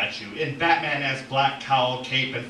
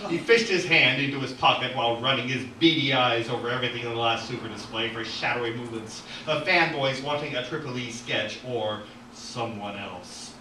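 ordinary delivery

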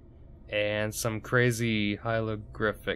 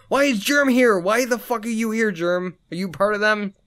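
high, loud disbelief; startled and indignant, pitched way up; shocked outburst; 10 semitones higher, 4 dB louder than usual. Real, not acted. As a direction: yell at the surprise arrival. Shocked at Germ appearing.

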